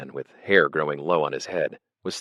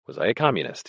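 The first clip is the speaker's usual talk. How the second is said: ordinary delivery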